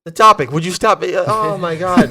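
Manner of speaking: loud, high exasperated complaint. Real, not acted. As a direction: scold him in disbelief. Host exasperated at Jeff.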